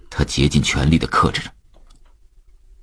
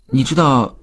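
put-on high-pitched little boy's voice; boy piping up with a question; child's question; 9 semitones higher than usual. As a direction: ask your father out of the blue. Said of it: "忽然又问".

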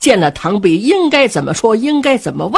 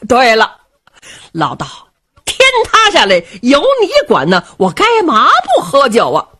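higher and louder, a boisterous carefree blusterer; brash jovial rogue; 5 semitones higher, 3 dB louder than usual. Acted. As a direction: boom it out like a merry rascal king who doesn't care. Cheng Yaojin voiced in story.

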